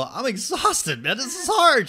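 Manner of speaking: high, slow, whiny complaint; hammed-up worn-out swimmer; mock exhausted. Swimmer telling his friend he is spent, high slow mock whine.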